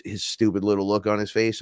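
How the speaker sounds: ordinary delivery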